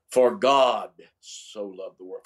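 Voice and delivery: GOD stretched, the rest running on lightly; stress on GOD. Shown as a demonstration. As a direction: lean hard on the word God. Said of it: "putting an inflection on a different word".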